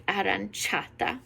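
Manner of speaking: "aranciata" with cia said as one syllable, cha. Shown as a demonstration. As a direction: model "aranciata" as a-ran-CHA-ta. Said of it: "C I A is sounding like cha".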